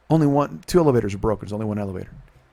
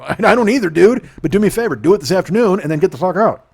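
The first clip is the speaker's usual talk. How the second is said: raised, higher voice, half-laughing at the end; retold loud retort; 7 semitones higher, 7 dB louder than usual. To the guy in court, a loud laughing retort. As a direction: fire it back louder and higher. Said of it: "I go".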